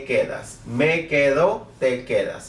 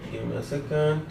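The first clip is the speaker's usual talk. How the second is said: soft, off-hand aside to oneself; quiet aside; 7 dB quieter than usual. Real, not acted. Teacher stalling viewers while setting up, quiet aside. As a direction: drop the voice while busy with something.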